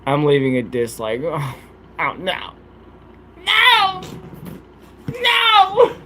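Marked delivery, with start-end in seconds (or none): 3.5-6.0 very high mock squeal of protest; a pretend shriek of no, just kidding.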